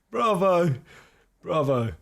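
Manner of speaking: ordinary delivery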